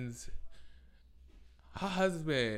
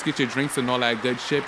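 usual speaking voice

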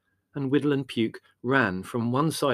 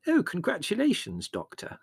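polite mother; 10 semitones higher than usual. A narrator's reading. Mother congratulates the doctor.

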